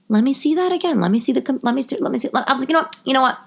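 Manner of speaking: high, agitated, stumbling demand; acted-out rattled fury; retold fuming. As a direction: trip over the words as anger rises. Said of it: "steam coming out of my ears, hair on fire".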